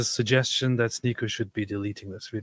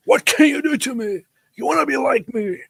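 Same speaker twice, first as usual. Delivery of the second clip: much higher, hammed-up macho taunt; mock Mohammed Hijab; 13 semitones higher, 5 dB louder than usual. Streamer parodies Mohammed Hijab's challenge, much higher and hammed-up. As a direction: puff up and taunt like a cartoon tough guy. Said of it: "act like Mohammed Hijab and be like".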